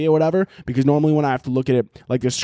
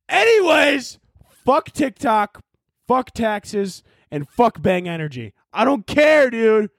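angry outburst; 9 semitones higher, slower than usual. Real, not acted. Vlogger cursing out TikTok and sponsors, shouting. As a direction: spit out each curse.

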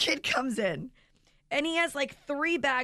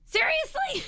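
much higher, louder "Seriously?" in shock; exasperated disbelief; 4 dB louder than usual. Retold or imitated. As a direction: shriek your disbelief at the wrong pasta. Mother exasperated at wrong pasta.

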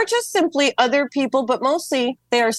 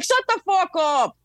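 higher, slow mock-harsh parent; Gen X parents; 7 semitones higher than usual. Gen X parents silencing a whining kid, hammed-up harsh. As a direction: bark it like a fed-up parent, hammed-up. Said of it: "They were like".

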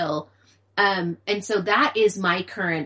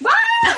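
loud, very high frustrated scream; exasperated scream; 8 dB louder than usual. Retold or imitated. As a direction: let out a burst of mom frustration. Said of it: "And I was like".

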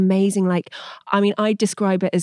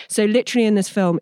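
ordinary talk, nothing marked

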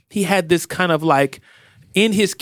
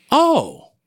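surprised; 4 semitones higher than usual. Retold or imitated. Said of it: "that made me go".

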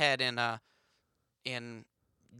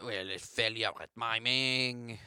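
put-on French accent; 5 semitones lower, 4 dB quieter than usual. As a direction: echo it ruefully in a French accent. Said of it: "my French accent isn't that good".

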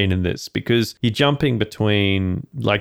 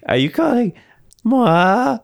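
mock indignant; 4 dB louder than usual. Mock indignant movie quote.

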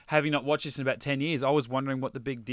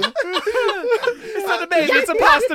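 much higher, slow, hammed-up Italian accent, laughing; mock Italian accent; 9 dB louder, slower than usual. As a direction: stretch it out in a joke Italian accent. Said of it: "Why is she Italian?".

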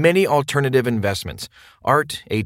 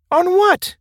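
much higher, louder demanding question; incredulous question; 5 dB louder than usual. Acted. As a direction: fire it back in disbelief. Imagined listener asks back.

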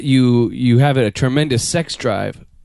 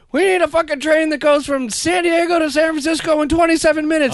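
put-on high-pitched ranting old politician; Jerry Brown impression; 12 semitones higher than usual. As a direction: rant about the train like the governor. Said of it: "He just walked around".

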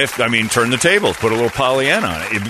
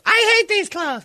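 very high, complaining woman's voice; high whiny woman's voice; slower than usual. Retold or imitated. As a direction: squeal it like a fed-up wife. Wife rejecting compliment.

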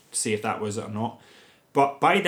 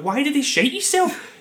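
much higher and louder in disbelief; exasperated rhetorical question; baffled; 5 dB louder than usual. Retold or imitated. Reliving her confusion at the film, high and incredulous. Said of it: "I'm like".

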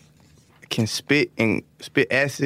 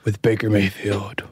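movie announcer voice; 4 semitones lower than usual. Pretend. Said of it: "give me your movie announcer voice, very deep".

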